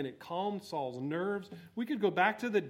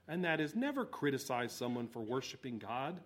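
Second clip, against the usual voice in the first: usual speaking voice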